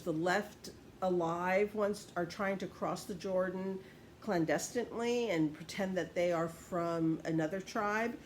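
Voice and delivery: usual speaking voice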